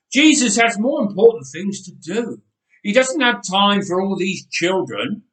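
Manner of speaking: loud, dismissive helper voice; cold official. A narrator's reading. Helpers telling parents to leave, loud and cold. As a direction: brush the families off.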